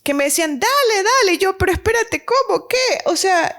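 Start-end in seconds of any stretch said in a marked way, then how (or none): 0.6-1.3 higher, pushy hurry-up from the nurse; urging nurse. Retold or imitated. Nurses pushing the teen mother to feed, urgent. 1.6-2.8 high, flustered questions; confused protest. Retold or imitated. Teen mother answering the nurses, flustered and confused.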